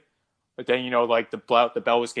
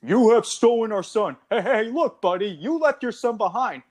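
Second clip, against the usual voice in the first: acted characters; 10 semitones higher than usual. Acting the alien and Buck exchange.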